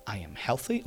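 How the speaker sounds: soft, quiet hint of an American accent; a hushed kid's American accent. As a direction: son murmuring the affirmation in a light American accent. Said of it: "he's got a, an American accent".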